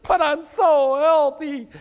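put-on high, slow, loud old lady's whine; old aunt's lament. Pastor voicing his aunt, sad she is healthy. Aunt falls back, complains.

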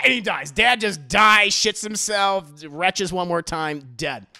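usual speaking voice

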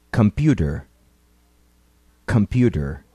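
Computer with the T as a quick tap; flapped T. Shown as a demonstration. Teacher models Computer twice, flapped T. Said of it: "Ouça e repita".

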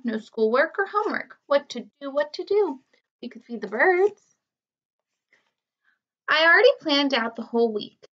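lively storybook reading with character voices; reading to children. A narrator's reading. Teacher reading the chapter book to her students. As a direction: read it to a class of kids.

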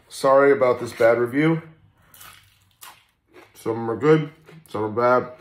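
chewing onion ring; talking between bites; mouth full. Real, not acted. Seen 1: Picks up onion ring, bites it mid-sentence, then chews.

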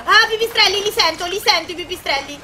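high, loud alarmed cry at bats; scared gamer squeal; startled fright. Real, not acted. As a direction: yelp as the bats arrive. Bats suddenly appear.

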